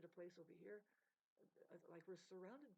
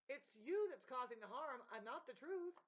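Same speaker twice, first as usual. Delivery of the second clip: heated accusation; 6 semitones higher, 8 dB louder than usual. Real, not acted. Ranting accusation.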